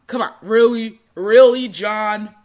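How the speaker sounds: a taunting inner voice, lightly voiced, loud bursts on "really"; mocking ego voice. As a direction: jeer at yourself like a doubting ego. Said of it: "your ego is gonna say".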